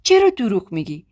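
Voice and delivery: stunned disbelief. A narrator's reading. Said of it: "با تعجب و خشم تو چشام نگاه کرد". (با تعجب، آرام)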